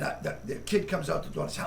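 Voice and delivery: ordinary talk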